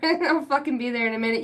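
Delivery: usual speaking voice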